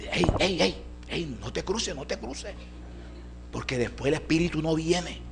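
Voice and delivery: lightly voiced young man giving a warning; young helper. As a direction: raise the pitch, quick hushed-down telling-off. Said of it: "entonces llegó aquel muchacho".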